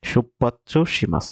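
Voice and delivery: slow, drawn-out "সুপাচ্ছ সিমাস" with the u nearly dropped; careful pronunciation demo. Shown as a demonstration. Teacher models the Japanese for Bengali learners, slow and careful. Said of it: "এইভাবে উচ্চারিত হবে এটা".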